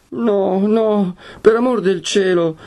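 high wail of distress; tearful pleading. A narrator's reading. Lucia begs her betrothed to stop, high and tearful. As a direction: plead as tears take the voice. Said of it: "il pianto le troncò la voce".

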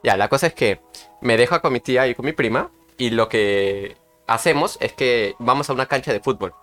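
ordinary talk

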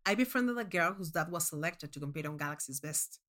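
usual speaking voice

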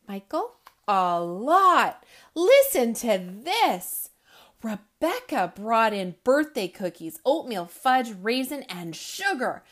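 put-on animated children's book reading, loud punchlines; lively read-aloud. A narrator's reading. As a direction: read it with big comic energy for kids. Reads picture book aloud.

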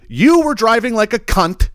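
retold angry retort. Retold road rage argument.

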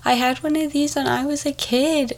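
put-on high, gushing old lady; excited grandma. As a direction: gush like a delighted grandma. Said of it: "grandmas come up to me".

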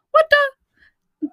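high squeaky what the; a comic high yelp of surprise; mock squeak of surprise. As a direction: squeak it in disbelief.

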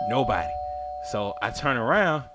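normal talking voice

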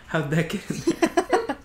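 very high, slow laughing question; faux innocent. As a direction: play it coy and innocent. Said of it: "He's like".

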